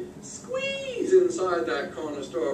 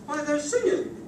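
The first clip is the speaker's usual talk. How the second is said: proud frog; 8 semitones higher than usual. Acted. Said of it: "He says".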